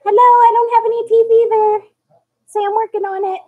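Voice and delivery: put-on high, loud speaking-for-baby voice. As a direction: voice the baby talking to viewers.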